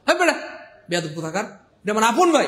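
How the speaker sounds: loud scolding with a punch on আপন. Acted. Sibling scolding a rude friend about his own brother. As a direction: tell him off, stressing আপন.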